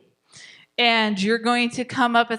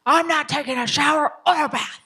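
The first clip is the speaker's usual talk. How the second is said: put-on louder, higher stubborn kid refusing; defiant child; 5 semitones higher, 4 dB louder than usual. Shown as a demonstration. Resistant child refusing bath, higher and louder. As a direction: play the stomping toddler saying no. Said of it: "They stomp their feet".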